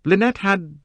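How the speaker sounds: intense, pleading-to-be-understood woman's voice; young woman Jacqueline, earnest. Acted. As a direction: start hesitant, then argue with conviction. Narrator voices Jacqueline explaining.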